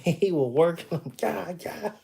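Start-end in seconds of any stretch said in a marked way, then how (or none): none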